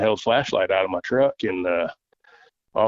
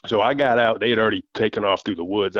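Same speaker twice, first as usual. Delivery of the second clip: ordinary talk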